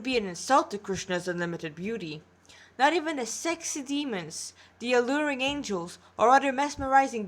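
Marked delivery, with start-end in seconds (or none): none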